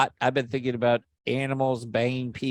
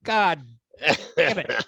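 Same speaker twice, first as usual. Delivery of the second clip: mock frustration; slower than usual. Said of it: "I'm like".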